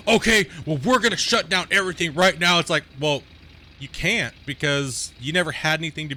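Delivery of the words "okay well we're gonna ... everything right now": louder, higher threatening scammer voice; mock angry scammer. Imitating a scammer yelling threats at him, louder and higher. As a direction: play the furious scammer threatening to shut it all down. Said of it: "they just go absolutely ballistic".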